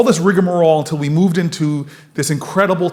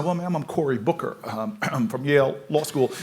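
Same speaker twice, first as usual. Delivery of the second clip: much softer, a hint of the earnest young lawyer; naive young lawyer; 3 semitones lower, 7 dB quieter than usual. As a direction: lightly voice an overpolite rookie. Retells his naive young self.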